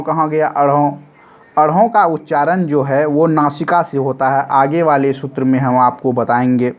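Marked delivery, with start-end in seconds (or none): none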